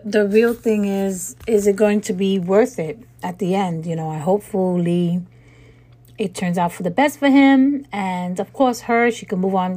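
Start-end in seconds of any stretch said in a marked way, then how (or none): none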